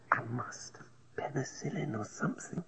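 breathless sick whisper; weak whisper. A narrator's reading. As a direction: whisper it faintly, pleading. Weak sick woman pleading.

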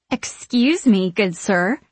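drawn-out, lilting appeal to a stranger; flirty witch approaching a man; coy flirtation. A narrator's reading. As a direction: coax him sweetly, slowly.

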